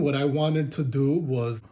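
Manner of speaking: ordinary delivery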